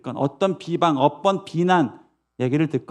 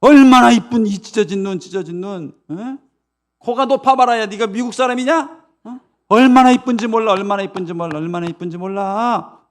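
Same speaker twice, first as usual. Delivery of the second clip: high, emphatic reassurance; acted mother's fervent insistence; insistent doting mother; 6 semitones higher, 6 dB louder than usual. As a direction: argue back that your child is lovely. Mother insists child is pretty.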